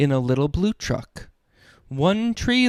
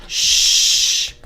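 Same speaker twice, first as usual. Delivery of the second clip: hushing shh. Acted. Dad reading a bedtime book hushes the listeners. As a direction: hush the sleepy trucks.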